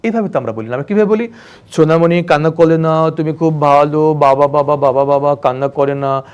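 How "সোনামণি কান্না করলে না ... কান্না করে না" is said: put-on parent comforting a crying toddler in doting baby talk; gushing baby talk. Shown as a demonstration. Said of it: "লদমদ গদগদ ভাষায়".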